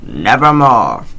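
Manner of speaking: slow, loud, strange-timbred croak; one dark word from the bird; raven's croak. Acted. Raven answering the narrator, slow ominous croak. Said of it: "Quoth the raven".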